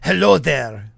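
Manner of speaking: mock Spanish conquistador accent. Host clowning as a conquistador, in a Spanish accent. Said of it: "Something, something Spanish accent".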